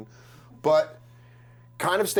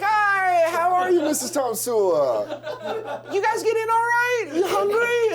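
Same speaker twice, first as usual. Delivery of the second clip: put-on nervous boyfriend; slower than usual. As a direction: squeak out a too-eager hello. Nervous boyfriend greeting the mom.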